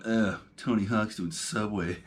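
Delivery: slow, sneering mock complaint; a disgusted scoff at a sellout; scornful grumble. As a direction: sneer it like a fed-up online critic. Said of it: "this switch flips where you go".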